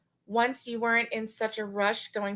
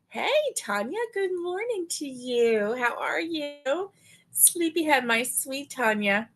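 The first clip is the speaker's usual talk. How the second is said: fond high greeting; 5 semitones higher than usual. Real, not acted. Greeting a viewer fondly.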